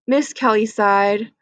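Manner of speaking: ordinary delivery, nothing marked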